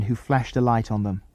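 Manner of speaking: ordinary delivery, nothing marked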